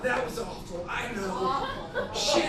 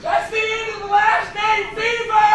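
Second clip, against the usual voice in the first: yelling; 4 semitones higher, 5 dB louder than usual. Real, not acted. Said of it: "I gotta yell".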